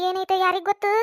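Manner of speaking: ordinary delivery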